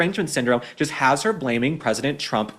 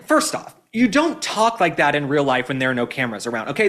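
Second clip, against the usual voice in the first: ordinary talk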